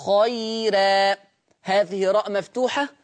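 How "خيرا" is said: خيرا drawn out very slowly, the ر light after the ي; very slow recitation. Shown as a demonstration. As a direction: stretch خيرا long and slow. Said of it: "أيضًا".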